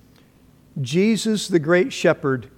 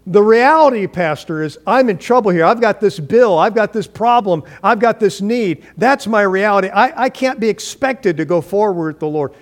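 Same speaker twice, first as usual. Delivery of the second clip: higher, louder, pleading voice of a worried churchgoer; a desperate parishioner objecting, raised and pressing; anxious complainer; 5 semitones higher, 5 dB louder than usual. Retold or imitated. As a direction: play the person swamped by bills, protesting to the pastor. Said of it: "over our own reality".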